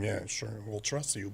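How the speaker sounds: low, soft sarcastic aside. Real, not acted. Voter answering Elections Canada's admitted mistakes, sarcastic. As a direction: drop low and quiet in mock agreement.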